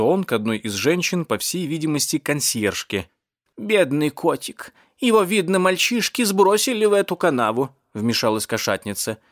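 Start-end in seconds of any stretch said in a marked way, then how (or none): 3.6-7.8 high-pitched, fussing cat lady; cat lady, pitying. Acted. Cat lady explains the poor kitty's fall, pitying.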